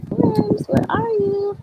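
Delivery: high, playful sing-song call to hiding worms. Acted. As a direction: coax the worms out, high and light. Calling to worms in the bin.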